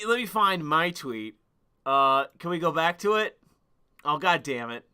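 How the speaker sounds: ordinary talk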